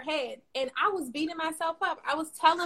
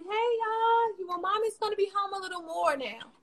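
high, cheery covering voice; fake upbeat mom; 8 semitones higher, 4 dB quieter than usual. Mom telling her kids she'll be home more, forced cheer. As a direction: hide the pain behind a bright tone. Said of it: "And I'm like".

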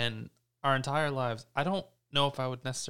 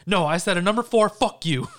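loud, high imitation of a blow-up; hammed-up furious yell; mock angry outburst; 6 semitones higher, 9 dB louder than usual. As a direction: explode at the drive-through worker, mimicking a friend. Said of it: "you went from zero to a hundred".

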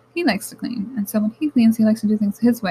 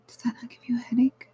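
hushed, conspiratorial ask to viewers; breathy aside to the audience; whispered question; 3 semitones higher, 4 dB quieter, faster than usual. Real, not acted. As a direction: whisper it like a shared secret. Confiding rhetorical question to viewers.

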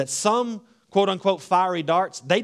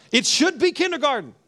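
high, louder, laughing through it; laughing exclamation; 9 semitones higher, 4 dB louder than usual. Real, not acted. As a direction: laugh it out in disbelief.

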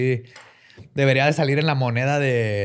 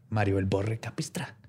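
whisper; 6 semitones lower, 9 dB quieter than usual. Acted. Mock solemn introduction.

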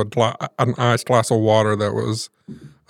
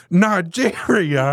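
high, slow, drawn-out disbelief; Southern grandma startled by a charge from Nigeria; shocked grandma; 6 semitones higher, much slower than usual. Retold or imitated. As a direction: stretch out the word like she can't believe it. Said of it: "She goes".

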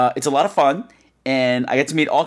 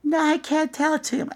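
put-on little girl voice; 14 semitones higher, 4 dB quieter than usual. Katie greets Mister Plummet, a high little-girl voice. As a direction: chirp it like a six-year-old girl.

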